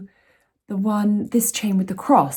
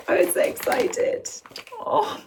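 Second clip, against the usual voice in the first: excited; 13 semitones higher, slower than usual. Real, not acted. Opening the long-awaited necklace parcel.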